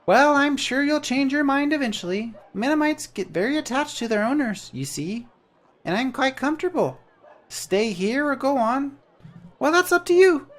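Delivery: a tiny bird-sized helper, cheerful; chirpy little creature. Acted. Jan introduces himself to the traveller, high and chirpy.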